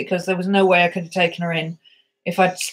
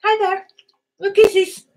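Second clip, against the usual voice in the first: much higher voice greeting the dog; high cooing pet talk; 10 semitones higher than usual. Real, not acted. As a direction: squeak at the dog.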